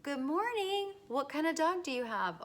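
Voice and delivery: a lightly voiced chatty neighbour; friendly neighbour reply. Neighbour returning the greeting, lightly voiced and friendly. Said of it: "the person says back".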